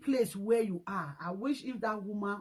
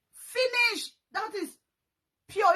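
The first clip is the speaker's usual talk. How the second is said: 高く大きく言い切る; emphatic exclamation; 11 semitones higher, 5 dB louder, slower than usual. Real, not acted. Ranting verdict exclamation.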